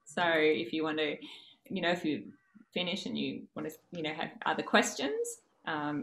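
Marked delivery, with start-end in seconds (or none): none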